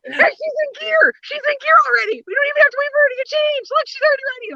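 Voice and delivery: very high, squealing mock excitement; hammed-up, high-pitched frantic announcement; imitated excited screaming. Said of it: "like, screaming in the car".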